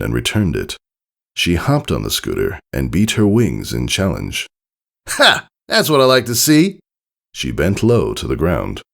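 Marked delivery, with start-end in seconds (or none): 5.1-6.8 high, breezy, cocky tomboy voice. Acted. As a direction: toss it off with a grin. Rainbow Dash pleased.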